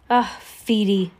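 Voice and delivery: a restrained storybook shriek, slowed down; mild shriek. A narrator's reading. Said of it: "Sonny shrieked".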